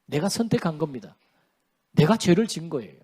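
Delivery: ordinary talk, nothing marked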